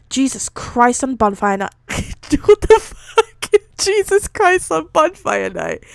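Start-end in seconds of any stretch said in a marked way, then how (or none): 1.9-5.8 high, slow, through real laughter; laughing disbelief. Real, not acted. Reader baffled by bonfire night phrase, laughing incredulously. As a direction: squeal it while cracking up.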